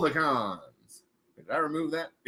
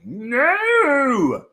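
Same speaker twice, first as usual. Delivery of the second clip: anguished no; 5 semitones higher, 6 dB louder than usual. Real, not acted. Reacting to lag.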